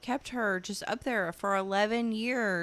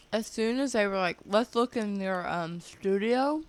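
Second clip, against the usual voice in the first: mouthful of ice, a bit louder; 5 dB louder than usual. Real, not acted. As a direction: still talking through ice. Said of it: "sorry, I got ice in my mouth".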